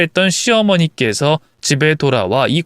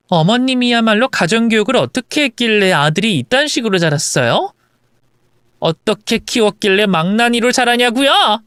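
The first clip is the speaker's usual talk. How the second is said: wife's defiant retort; 6 semitones higher than usual. Acted. Wife questioning mother-in-law's parenting in return, defiant. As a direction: throw the reproach back at her.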